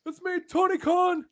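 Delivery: very high mock showman voice; hammed-up high-pitched host impression; Tony Khan. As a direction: squeal a big greeting, then ease off. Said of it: "He's like".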